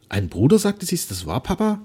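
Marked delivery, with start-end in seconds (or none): 0.1-0.6 louder, delighted disbelief; amazed at the happy news; joyful amazement. A narrator's reading. Daughter learning she has a brother, joyfully astonished. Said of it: "in freudigem Staunen". 0.9-1.8 high, astonished question; eager for it to be true; joyful amazement. A narrator's reading. Daughter asking father if she has a brother, joyfully astonished. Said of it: "in freudigem Staunen".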